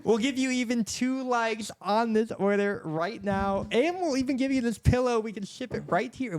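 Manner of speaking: high, silly parody of an infomercial offer; mock infomercial pitchman. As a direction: play along as a hammed-up TV seller.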